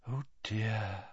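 soft, hushed dismay; breathed. A narrator's reading. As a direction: breathe it out, barely voiced. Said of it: "breathed Slughorn".

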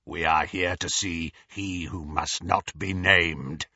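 low raspy werewolf growl; werewolf rasp. A narrator's reading. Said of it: "rasped Greyback".

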